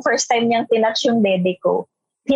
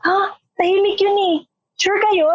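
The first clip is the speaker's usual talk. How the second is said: mock disbelief; 7 semitones higher than usual. Retold friends doubting the pairing.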